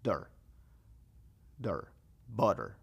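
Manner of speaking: slow "der, der, butter" stretching the ending; "butter" ending in a soft der. Shown as a demonstration. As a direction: draw out "der" before "butter". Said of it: "se escucha como diciendo".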